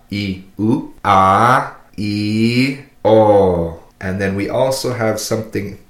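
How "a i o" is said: "a, i, o" each held long and separately; dragged vowels. Shown as a demonstration. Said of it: "our three drag vowels".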